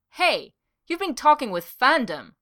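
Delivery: loud, high indignant cry; a jealous coworker blurts out his shock; surprised outburst. Acted. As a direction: catch him out, shocked and loud. Jealous discovery of rival's chat.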